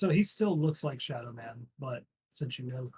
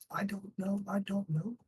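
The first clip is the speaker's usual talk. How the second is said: higher-pitched scared guard repeating himself; panicked denial; 7 semitones higher than usual. Acted. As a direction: blurt it out, frightened. GM voices frightened guard.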